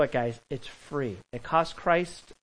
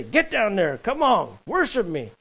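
high, raised-voice demand; pushy, loud and high mock order; mock bossy command; 6 semitones higher, 3 dB louder than usual. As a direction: order them about, hammed up. Mock order Jesus didn't give.

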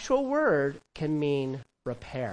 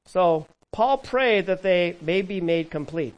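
ordinary talk

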